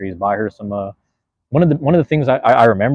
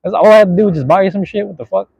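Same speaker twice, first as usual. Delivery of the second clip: loud, high-pitched mock disbelief; reenacted incredulous outburst, pitched way up; retold amazed outburst; 9 semitones higher, 7 dB louder than usual. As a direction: exclaim it loudly, as if just realizing. Acting out his own amazed realization.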